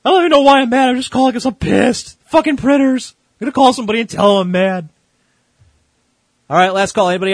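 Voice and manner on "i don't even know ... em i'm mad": high, loud mocking voice of the angry caller; imitating mad caller. Host mimics the caller after he hangs up, a high loud mock-angry voice. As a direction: parody the caller's rant. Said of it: "host mimics caller again".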